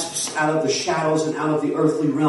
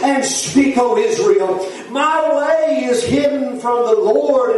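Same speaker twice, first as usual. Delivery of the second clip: raised, driving revival-preacher delivery; full-force preaching; 6 semitones higher than usual. Real, not acted. Preacher urging the church through the wilderness, fervent preaching. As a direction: preach it with mounting fervor.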